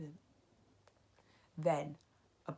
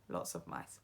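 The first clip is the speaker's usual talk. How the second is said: whisper; 12 semitones lower, 6 dB quieter than usual. A narrator's reading. Whispered aside in song.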